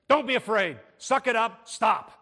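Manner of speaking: put-on loud, gruff dad snapping at a scared kid; harsh father telling his frightened son to stop; stern dad. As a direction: bark it like an impatient father. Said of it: "dad just comes in and says".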